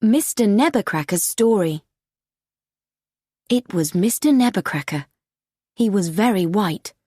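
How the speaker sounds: plain reading voice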